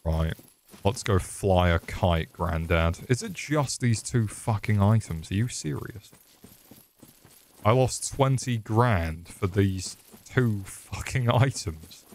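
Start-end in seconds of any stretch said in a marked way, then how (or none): none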